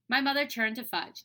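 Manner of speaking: usual speaking voice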